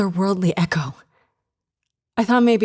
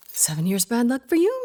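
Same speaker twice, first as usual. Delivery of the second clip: ordinary talk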